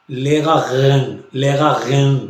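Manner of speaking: लेगा गैन said slowly, syllable by syllable; drawn-out German pronunciation; लेगा गैन spelled out carefully for learners; slow German demo. Shown as a demonstration. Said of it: "कहीं असल इनका एर जो है वो अगर".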